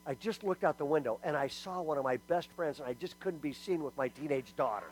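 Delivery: quietly owning up, mildly sheepish; sheepish apology. Retold or imitated. Mom explains herself to her daughter, mock-sheepish.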